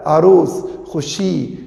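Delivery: ordinary delivery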